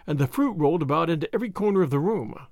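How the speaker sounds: usual speaking voice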